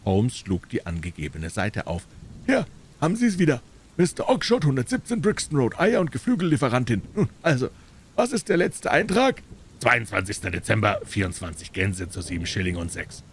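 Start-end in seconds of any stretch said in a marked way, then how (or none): 2.5-9.4 hohe, laute Händlerstimme; triumphierender Händler. A narrator's reading. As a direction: auftrumpfend den Kunden abfragen. Dealer challenges Holmes in story. (rechthaberischer Geflügelhändler)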